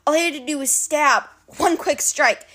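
usual speaking voice

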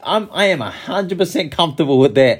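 high, animated retelling of Chance; [name] impression. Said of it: "and he's like".

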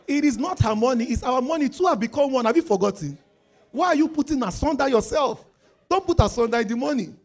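preacher rebuking a proud husband, loud; preaching rebuke. Real, not acted. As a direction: scold the husband from the pulpit.

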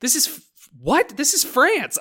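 high, loud disbelief; mock-outraged "what?"; incredulous reaction. Acting out reaction to English accents.

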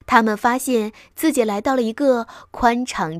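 ordinary delivery, nothing marked

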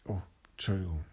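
ordinary delivery, nothing marked

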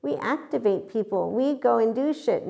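high, put-on boasting; mock boastful activators. Mimicking people who claim they activate others, mock boastful voice. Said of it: "when they're saying".

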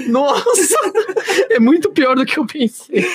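high, giggling disbelief; laughing. Real, not acted. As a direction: laugh through the words. Laughing at the coffee spill. (rindo sem parar)

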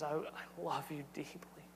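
tender, breathless whisper to a lost son; father's whisper. Acted. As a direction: whisper it like a father holding his son close. Father speaks to returning son.

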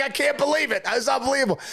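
high, excited near-shout of disbelief; overjoyed cry. Retold or imitated. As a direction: blurt it out like you can't believe your luck. Retelling call to parents, overjoyed.

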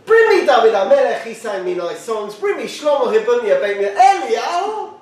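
high, building to a near shout; rising indignation. Real, not acted. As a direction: push the question up in indignation.

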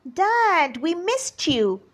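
put-on high, eager children's call; excited shout. A narrator's reading. Two boys greet their returning father, excited shout. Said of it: "they shouted".

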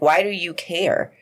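normal talking voice

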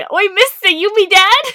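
high, loud mock-British urchin; bad British accent. Co-host copying the friend's joke, bad British accent. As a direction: belt it in a silly British accent. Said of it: "do a bad British accent".

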